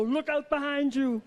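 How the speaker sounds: put-on urgent action-hero warning in Austrian accent; husband's mock urgent Austrian accent. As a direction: warn like Schwarzenegger, hammed-up Austrian accent. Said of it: "he says them so urgently".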